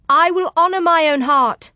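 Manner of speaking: high, loud proclamation; ringing tones. Acted. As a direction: let the voice rise and ring out. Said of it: "still letting her voice rise in ringing tones".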